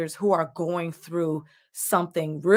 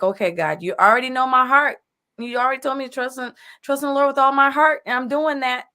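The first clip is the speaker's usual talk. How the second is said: higher and louder, earnest mock prayer; pleading prayer; 8 semitones higher, 6 dB louder than usual. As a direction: plead with God in a raised voice, half laughing. Imitating a believer praying.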